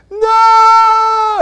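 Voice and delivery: shrill, very high and loud shriek of refusal; wife's scream. Retold or imitated. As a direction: scream "No!" at full force, mocking the wife. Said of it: "She didn't scream like that".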